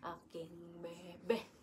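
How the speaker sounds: slow, soft whisper; slow hushed whisper. Real, not acted. Mother whispering to her baby.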